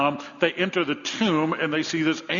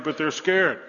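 ordinary delivery

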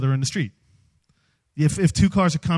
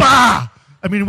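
normal talking voice

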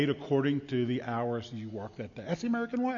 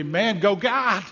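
mock cheer; 4 semitones higher, 5 dB louder, much slower than usual. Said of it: "I look at him and say".

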